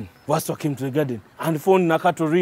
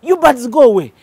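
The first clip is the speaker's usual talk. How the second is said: loud, higher shouting to scare birds; chasing birds away; 7 semitones higher, 8 dB louder than usual. Acted. Nakato shouting at the birds to leave. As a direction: yell to shoo the birds. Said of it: "Nakato started chasing them away".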